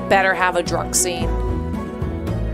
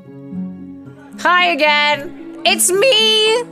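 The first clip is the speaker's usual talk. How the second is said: high, drawn-out nervous hello; awkward sing-song greeting; 9 semitones higher, much slower than usual. Acted. Shy newcomer greeting everyone at the bar, awkwardly high. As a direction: squeak it out, stretched and high-pitched.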